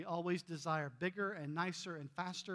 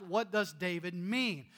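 ordinary delivery

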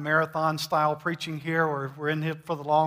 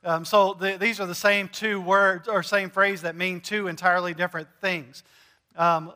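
ordinary delivery

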